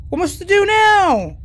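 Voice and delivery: high, loud cry of frustration; panicked outburst. Real, not acted. Cornered gamer with no idea what to do, panicked. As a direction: wail it loudly, at a loss.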